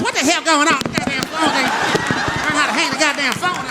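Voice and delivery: lightly voiced granny griping to herself; grandma muttering. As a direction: grumble at the phone like grandma. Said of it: "talking to herself".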